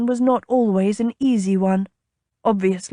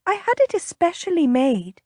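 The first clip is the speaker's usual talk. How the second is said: higher-pitched, coaxing voice; enticing; 9 semitones higher than usual. A narrator's reading. Lady tempting the captain with perfume, enticing. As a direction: entice him in a high voice. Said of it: "she said enticingly".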